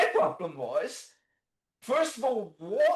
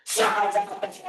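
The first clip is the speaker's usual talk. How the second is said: mock shouting; 12 semitones higher than usual. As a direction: bark the order at the patient. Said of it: "randomly scream at him".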